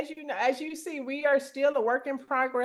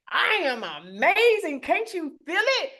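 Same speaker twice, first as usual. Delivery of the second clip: exuberant; 5 semitones higher than usual. Retold or imitated. Answering a greeting at the pickleball court, exuberant. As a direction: stretch it out with gleeful swagger.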